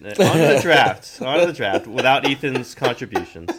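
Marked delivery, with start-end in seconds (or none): 0.1-1.2 slow, louder, drawn-out announcement; announcing. Acted. Host to listeners, a slow, louder announcer call. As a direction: boom it out like a show host.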